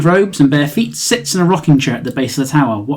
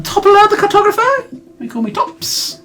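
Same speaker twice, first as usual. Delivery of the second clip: very high chirpy introduction; eccentric old woman. Acted. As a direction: announce herself grandly, high. NPC introduces herself.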